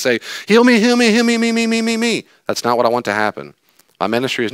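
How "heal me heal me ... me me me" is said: high, loud whiny chant of heal me; mock a greedy healing-seeker; hammed-up whiny plea, high and loud; mock demanding seeker.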